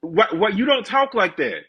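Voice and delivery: what, you don't talk like that, said high and loud; exasperated at the fake influencer voice. Real, not acted.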